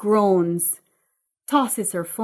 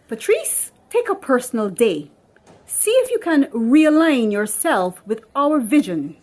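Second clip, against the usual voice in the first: curt manager, louder, sharp high call of the name; Miss Beckles; 3 semitones higher, 7 dB louder than usual. Acted. Miss Beckles sending Patrice home, curt and louder. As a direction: dismiss her with icy politeness.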